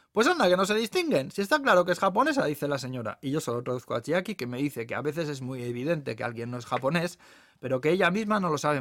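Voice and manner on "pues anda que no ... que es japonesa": high, loud, quick retort; indignant lady. Acted. Lady contradicting the waitress, indignant and loud. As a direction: argue back in a raised, hurried voice.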